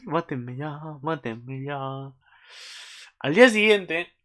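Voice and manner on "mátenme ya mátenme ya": soft, drained plea; spent fan despair. Real, not acted. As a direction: let it fade out quietly, overwhelmed. Overwhelmed, begs to be killed.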